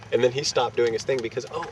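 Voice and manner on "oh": plain reading voice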